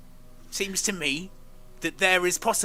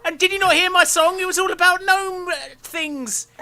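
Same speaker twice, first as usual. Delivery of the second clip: very high, loud gnome, giggling; laughing gnome; 10 semitones higher, 4 dB louder than usual. Acted. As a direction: show off the gnome face, cracking up.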